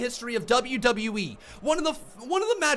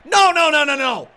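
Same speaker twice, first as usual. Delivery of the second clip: angry shouting; 6 dB louder than usual. Real, not acted. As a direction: shout it down as it happens. Fan reacts to disqualification.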